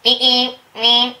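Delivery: high loud cartoon beeping; player's honk turning into a cartoon beep; Roadrunner beep. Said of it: "sounded like the Roadrunner".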